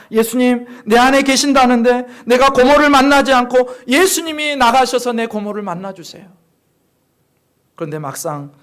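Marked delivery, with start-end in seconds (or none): 0.1-6.3 크고 힘차게 부르짖는 기도; 소녀의 기도를 목청껏 간청하듯; 간절한 기도. Acted. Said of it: "이 소녀가 기도합니다". (크게 부르짖어 기도)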